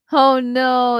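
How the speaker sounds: hammed-up mock sympathy; mock dismay. Real, not acted. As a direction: play up the pity, tongue in cheek.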